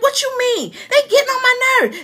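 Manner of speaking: high-pitched, quickening mock protest; indignant retort. Wife re-enacting her comeback to her husband, indignant. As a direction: imitate an exasperated parent arguing back.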